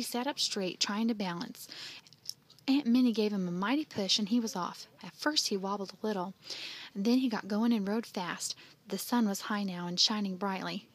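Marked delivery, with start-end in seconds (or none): none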